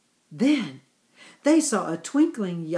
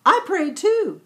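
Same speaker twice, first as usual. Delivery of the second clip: put-on higher, small child's happy voice; smiling child; 9 semitones higher, 5 dB louder than usual. A narrator's reading. Little Yimmy telling family he prayed, high smiling voice. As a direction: say it with a proud little smile. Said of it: "smiled Yimmy Yak".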